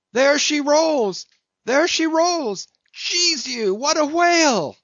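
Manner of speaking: high, loud sailor's cry of a sighted whale; excited shout. A narrator's reading. Teneriffe man shouting to the crew on spotting the whale, loud and high. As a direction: bellow it out across the deck, thrilled. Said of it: "all at once shouted out".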